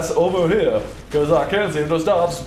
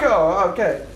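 ordinary delivery

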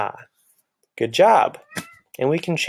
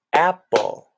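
"Apple." split into two higher beats; clapped syllables; 5 semitones higher than usual. Shown as a demonstration. Teacher clapping a word out with the class. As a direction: clap out "Apple." ap-ple. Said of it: "Let's clap it out".

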